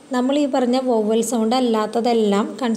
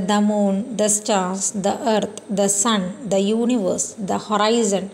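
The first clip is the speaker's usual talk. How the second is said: slow example reading; 3 semitones lower, much slower than usual. Shown as a demonstration. Teacher reads textbook examples to class, very slowly. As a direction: list each example very slowly. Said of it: "examples നമ്മളിതിൽ കൊടുത്തിട്ടുണ്ട്".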